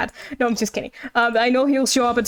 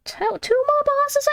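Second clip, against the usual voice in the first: surprised; 14 semitones higher, slower than usual. Real, not acted. Surprised by quest list.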